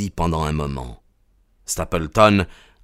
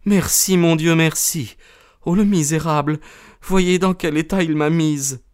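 high-pitched female character voice; high, from relief into indignation; distressed woman; 8 semitones higher than usual. A narrator's reading. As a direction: play the wronged wife, voice raised in pitch. Rescued woman's relief, then bitterness.

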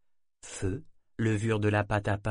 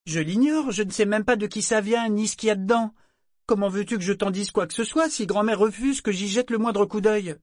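much higher, louder indignant protest; indignant girl; 11 semitones higher, 6 dB louder than usual. A narrator's reading. Daughter protesting her ignorance to her mother. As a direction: argue back sulkily.

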